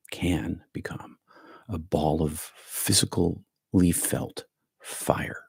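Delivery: slow, hushed whisper for effect; dramatic whisper. Acted. Teacher whispers the fire image to the group, slow dramatic whisper. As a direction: drop to a slow secret whisper. Repeats phrase, then whispers it.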